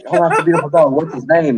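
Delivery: high, cooing praise of the dog; waiting-room stranger fawning over the dog, high; gushing stranger. Retold or imitated. Man gushing in psychiatrist's waiting room.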